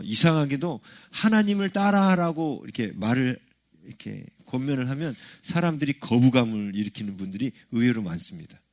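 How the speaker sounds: ordinary talk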